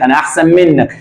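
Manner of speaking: ordinary delivery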